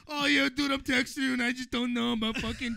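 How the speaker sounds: loud, high, laughing mock of a friend; mock loud buddy voice. Mocking his friend's texting worries in a loud laughing voice. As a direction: ham up a clueless bro, loud and high.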